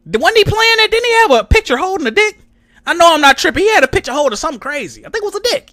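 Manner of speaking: high, disbelieving rush; half-laughing disbelief at the picture; high, fast, stunned questioning; incredulous. Real, not acted. Incredulous recall, high pitched.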